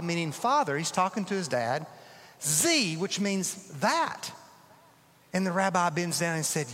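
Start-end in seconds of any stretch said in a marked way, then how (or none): none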